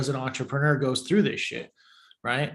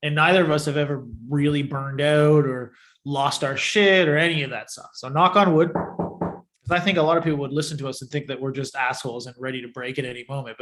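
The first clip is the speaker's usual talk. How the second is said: normal talking voice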